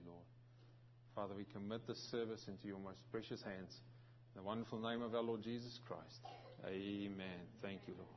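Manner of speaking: hushed, reverent opening prayer. Acted. Pastor opens the service in prayer, hushed and reverent. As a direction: pray softly and humbly to the Lord. Pastor prays before the sermon.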